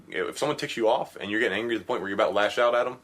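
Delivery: usual speaking voice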